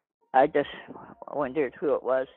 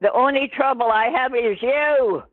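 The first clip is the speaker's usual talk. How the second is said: higher, raised repeat of a retort; exasperated; 7 semitones higher, 4 dB louder than usual. Real, not acted. Callee to confused caller after a huh, exasperated. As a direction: say it again for someone who missed it, fed up.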